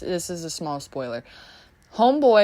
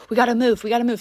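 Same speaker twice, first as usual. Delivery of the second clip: higher and louder urging, repeated; urgent hurry; 5 semitones higher, 6 dB louder, much faster than usual. Retold or imitated. Woman rushing the girl into the house, urgent and loud. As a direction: hustle her inside, a touch of panic. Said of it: "She's like".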